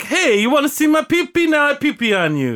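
put-on Italian accent. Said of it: "I use the Italian accent".

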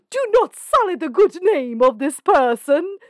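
hammed-up affronted declaration; mock-dramatic plea. Acted example sentence, dramatic.

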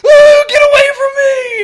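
very high, loud, slow laughing scream; hammed-up scream of fright; mock panicked shriek. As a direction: shriek in fake terror. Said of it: "he's like".

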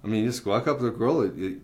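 ordinary talk

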